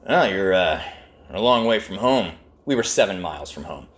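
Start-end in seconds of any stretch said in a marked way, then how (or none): 0.1-2.3 sheriff voice. Retold or imitated. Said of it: "and he goes".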